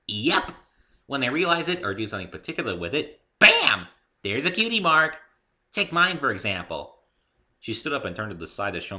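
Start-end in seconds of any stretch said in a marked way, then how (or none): 0.1-7.0 high, loud bouncy pony voice; bubbly pony. A narrator's reading. Pinkie explaining Spike to the newcomer, high and bubbly. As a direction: chirp it like a cheerful pony.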